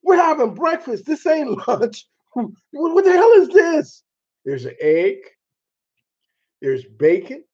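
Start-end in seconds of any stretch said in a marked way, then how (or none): 0.0-4.0 high, outraged disbelief at the plate; shocked disbelief. Retold or imitated. To the Brit over a plate with beans, shocked and high. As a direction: stare at beans for breakfast, appalled. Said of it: "I'm like".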